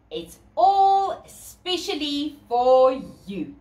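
ordinary delivery, nothing marked

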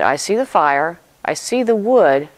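a hint of a child puzzling over the missing lamb; curious boy asking. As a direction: ask it like an observant young son. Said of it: "He said".

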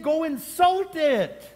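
high, loud, drawn-out cry; mock exasperated shout. Preacher correcting misguided believers, loud exasperation. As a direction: belt it out slowly in mock exasperation. Preacher mocking a misheard command.

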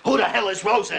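angry boss wanting an answer; irritated outburst. Acted. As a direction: snap it out with rising temper. Boss irritated at unknown name.

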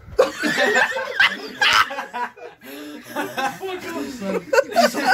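loud, high-pitched laughing burst. Real, not acted. Friend cracking up at his own joke, loud laugh. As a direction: cackle out loud.

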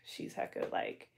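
unvoiced whispered aside. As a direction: whisper it behind her back. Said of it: "I'm gonna be like".

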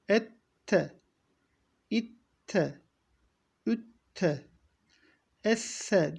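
Ette, İtte, Ütte, Esse with the consonant held double; doubled consonant syllables; doubled letter drill. Shown as a demonstration. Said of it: "harfler de bu şekilde devam ediyor".

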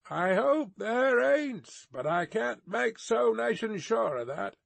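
high, doubtful grumble to himself; gruff soliloquy. A narrator's reading. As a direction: grumble misgivings in character. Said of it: "in a gruff soliloquy".

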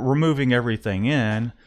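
ordinary talk, nothing marked

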